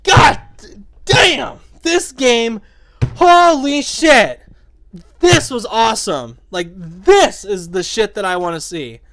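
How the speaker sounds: reliving it as a loud, high holler of triumph; big drawn-out cheer, easing slightly at the end; exhilarated shout. Retold or imitated. Player jumping up after the execution move, exhilarated shouting. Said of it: "I remember, like, standing up and going".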